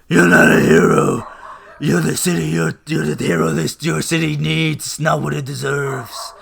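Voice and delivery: a hint of a gruff vigilante; mock Oliver. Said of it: "Oliver's like".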